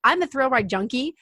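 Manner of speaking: usual speaking voice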